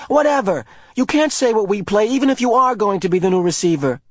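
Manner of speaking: high, loud and fast irritated retort; annoyed. A narrator's reading. Asher arguing back at Jonas. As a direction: brush him off heatedly.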